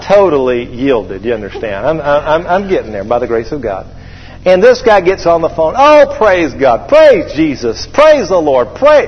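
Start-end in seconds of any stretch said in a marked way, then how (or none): 5.8-9.1 hammed-up gushing praise, climbing high; fanatical praise. Fanatic buyer answers the phone, gushing praise. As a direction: imitate an over-the-top believer, rising with each cry. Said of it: "this guy's a fanatic".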